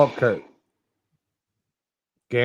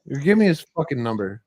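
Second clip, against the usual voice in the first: usual speaking voice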